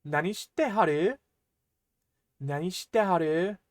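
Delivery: "Nani shite haru?" with Kyoto's polite haru, rising; Kyoto dialect. Shown as a demonstration. Teacher showing learners how Kyoto people ask this. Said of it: "In Kyoto, you might hear".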